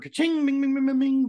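high singsong ka-ching bing bing; cash register jingle. Pretend. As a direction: chime it out like a till. Network jingle repeated.

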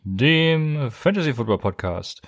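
drawn-out, grand show welcome; slow formal welcome. Acted. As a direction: stretch it like a mock-solemn host. Slow mock-formal opening.

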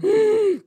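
high, short shocked gasp; mock gasp. Reacting to brother's teasing about the accent, mock-shocked gasp. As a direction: gasp as if caught out.

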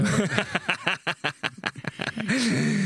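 helpless run of laughing; real laugh. Real, not acted. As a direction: crack up at the joke. Joke calling the film a masterpiece.

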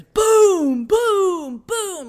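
big high, drawn-out booms; loud booming thuds. Retold or imitated. Storyteller imitates thuds upstairs, loud drawn-out booms. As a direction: boom it out loud like heavy thuds. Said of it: "loud thuds upstairs".

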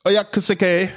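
pitched high, hammed-up Japanese accent; mock Japanese accent. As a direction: welcome him like a playful fake Japanese announcer. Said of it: "can speak a bit of Japanese".

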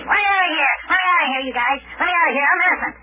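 high-pitched movie-prisoner yelling; hammed-up pleading yell, pitched high; mock prisoner scream. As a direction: play-act a jailbird crying out to be freed. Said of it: "they scream".

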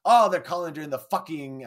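retold outburst, half-hearted yell; imitating a yell. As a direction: belt it out like an annoyed old boss. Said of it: "his yelling".

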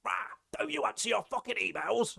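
high, rasping bellowed grumble; blustering boss demanding an answer; Tony impression. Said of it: "That's how he talks".

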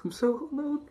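mock diva. Joking about fame, high voice.

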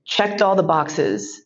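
usual speaking voice

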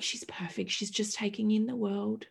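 soft retold soothing; quiet, tender reassurance; hushed reassurance. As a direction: imitate someone gently calming a new mother. Others reassure the new mother.

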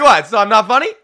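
loud, high incredulous protest; mock indignation. Comic answering imagined cancellers, mock indignant. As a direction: play up the outraged disbelief.

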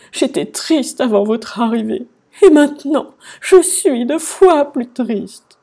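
theatrical sobbing. A narrator's reading. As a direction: wail it out as a sobbing king. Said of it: "il se mit à sangloter". (sanglots théâtraux)

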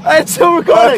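loud, high shouting over roller coaster noise; shouting over the ride. Real, not acted. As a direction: yell every word over the wind. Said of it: "You can hear me screaming for my life".

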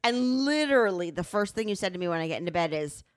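usual speaking voice

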